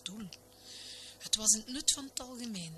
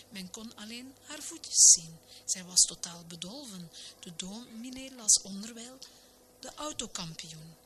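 ordinary delivery, nothing marked

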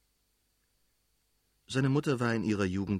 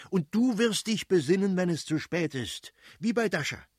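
raised, high-pitched indignation; irate, emphatic rebuke; angry warning; 9 semitones higher, 4 dB louder than usual. A narrator's reading. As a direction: warn the wife heatedly. Prince warns wife in acted quarrel.

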